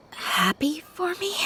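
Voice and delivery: slow, wondering whisper; shy whisper. Acted. Girl whispering in disbelief that the owner is happy. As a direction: barely voice it, touched and unsure.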